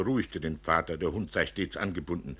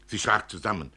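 ordinary delivery, nothing marked

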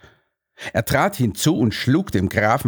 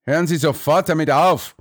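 higher, insistent command; exasperated chancellor's command; giving orders; 6 semitones higher than usual. A narrator's reading. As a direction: order him to stop at once. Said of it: "setzte der Kanzler seinen Befehlsreigen fort".

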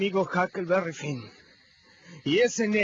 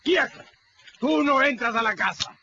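loud, high order to halt; a sharp loud command at the door; commanding shout; 7 semitones higher, 4 dB louder than usual. Acted. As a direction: bark the stop at him. Stopping the runaway at the door.

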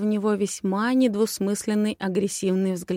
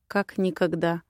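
usual speaking voice

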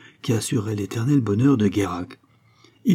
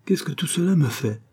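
cutting in impatiently, higher voice; mildly exasperated question, raised in pitch; 7 semitones higher than usual. A narrator's reading. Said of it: "interrompit Guérac, impatienté". (interruption impatiente, voix plus aiguë)

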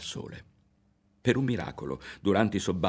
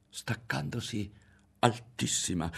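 plain reading voice

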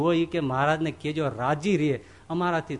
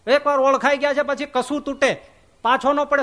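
1 proud defiance; 6 semitones higher than usual. Retold or imitated. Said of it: "ઉલટાનો તોર ચડે".

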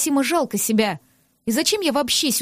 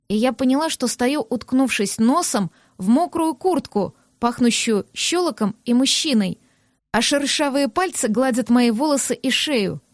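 ordinary delivery, nothing marked

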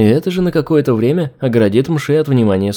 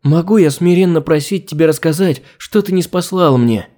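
humble pleading; 5 semitones higher than usual. Acted. Humble servant begs her god.

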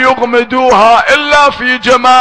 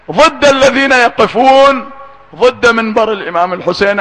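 normal talking voice

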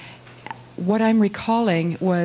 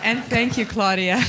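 usual speaking voice

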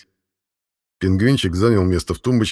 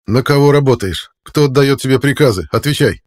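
demanding interrogation; 5 semitones higher, 5 dB louder than usual. Acted. Interrogator demands answers under torture.

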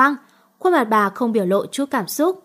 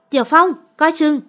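higher, louder urgent cry; warning shout; 5 semitones higher, 5 dB louder than usual. Acted. Friend warns Tiểu Phong of a knife, urgent shout. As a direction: yell the warning across the room.

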